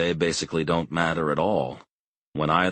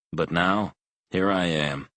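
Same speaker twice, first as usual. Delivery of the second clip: usual speaking voice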